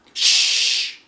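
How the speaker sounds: breathy hush; shushing. A narrator's reading. Mister Jack shushing Arlo, unvoiced hush. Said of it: "Mister Jack said".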